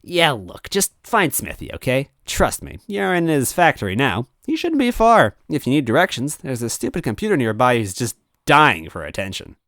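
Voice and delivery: high, bored villain brush-off; dismissive ghost Mario. Acted. Ghost Mario brushing off Toadette, high and careless. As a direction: wave them off lazily.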